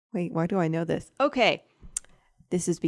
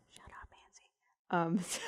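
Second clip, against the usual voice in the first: whispering; 12 dB quieter, much slower than usual. Real, not acted. Jokingly scolds herself aside.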